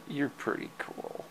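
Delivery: whispered praise to the cat; soft whispered compliment. Real, not acted. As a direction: breathe it out to the cat. Quiet aside to cat.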